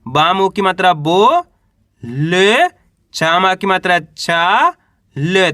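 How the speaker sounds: syllables stretched, rising on बो and चा; slow sounding out. Shown as a demonstration. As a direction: spell it out slowly so kids can follow. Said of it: "अब कैसे पढ़ेंगे इसको अपन?". (बो और चा पर सुर उठाकर धीरे धीरे पढ़ना)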